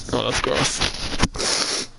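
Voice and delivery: breathy, slow disgusted aside; whispered aside. Real, not acted. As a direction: mutter it under your breath. Aside about booger, muttered.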